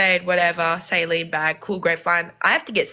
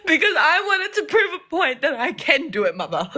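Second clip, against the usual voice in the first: laughing; 9 semitones higher than usual. Real, not acted. Laughing while telling defiant story.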